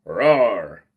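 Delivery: low mock roar, half-hearted dinosaur. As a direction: growl it low like a toy dinosaur. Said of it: "Or a dinosaur".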